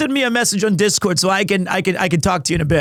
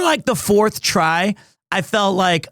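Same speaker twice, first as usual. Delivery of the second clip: normal talking voice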